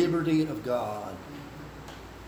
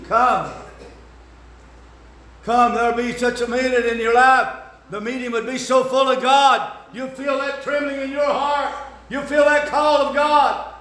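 high, loud pleading call to come; preaching altar call; 7 semitones higher, 6 dB louder than usual. Real, not acted. Preacher inviting the congregation to the altar, loud pleading call. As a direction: plead with them to come forward.

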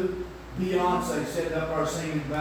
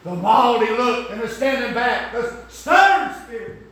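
1 rising preaching; 5 semitones higher, 5 dB louder than usual. Real, not acted. Preaching rising to full force.

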